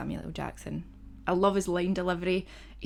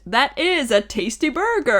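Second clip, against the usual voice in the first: loud, higher, drawn-out mock exclamation; exclaiming a film quote; 7 semitones higher, 9 dB louder, slower than usual. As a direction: belt out the movie line slowly, relishing it.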